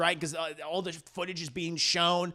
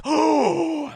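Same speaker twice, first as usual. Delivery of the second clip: mock gasp; 8 semitones higher, 7 dB louder than usual. Mock shock at agnostic.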